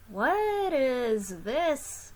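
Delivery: slow, drawn-out wondering question; puzzled question. Acted. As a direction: ask it slowly, baffled. Asking about strange robot.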